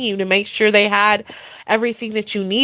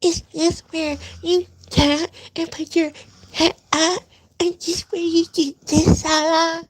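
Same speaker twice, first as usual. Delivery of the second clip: mock her as timid and sweet; mocking his wife's meek little voice; cute meek voice; 7 semitones higher than usual. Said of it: "put on the cute little meek voice".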